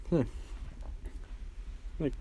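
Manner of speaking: ordinary talk, nothing marked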